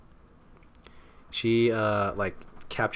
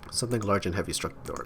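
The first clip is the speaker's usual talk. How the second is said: ordinary talk, nothing marked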